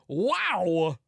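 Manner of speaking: loud, slow, stretched wonder; bad celebrity impression, rising high; Christopher Walken. Movie star reacting to the singing, drawn-out impression. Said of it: "you cut to him going".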